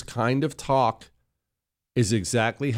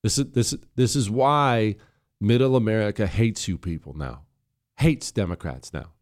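ordinary talk

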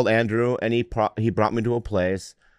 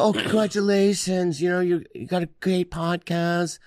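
mock admiring fan; 7 semitones higher, 3 dB quieter than usual. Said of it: "People go".

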